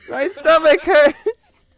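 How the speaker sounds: high, loud, slow laughing wail; laughed-out groan. Real, not acted. Streamer aching from friend's impression, laughed-out wail.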